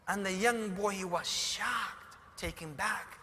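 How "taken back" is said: ordinary talk, nothing marked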